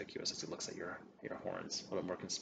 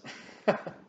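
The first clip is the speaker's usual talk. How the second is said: light laugh; 7 dB louder than usual. Acted. NPC laughs.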